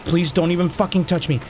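usual speaking voice

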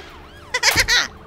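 high-pitched villain cackle; evil cackle. Pretend. Molly as Piggy closes in on Daisy, evil cackle. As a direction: let out a shrieking evil laugh. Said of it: "that's really evil".

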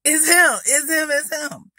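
high, loud repeated announcing; loud clowning. Retold or imitated. Girl outing a smelly boy to the lunchroom, loud and silly. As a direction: call him out in a high, mocking holler. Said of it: "So I'm loud".

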